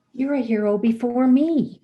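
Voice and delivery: high, loud hero praise; amazed admiration. Retold or imitated. Teller impressing the injured boy, acted amazed admiration. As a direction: exclaim it high and loud, full of wonder.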